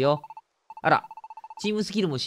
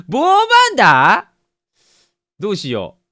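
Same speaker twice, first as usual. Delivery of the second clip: long, high, loud drawn-out shout; startled cry; 4 semitones higher, 10 dB louder, much slower than usual. Real, not acted. Gamer spotting a Salamence mid-conversation, a startled drawn-out shout. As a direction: yell the name in shock. Salamence suddenly appears in game.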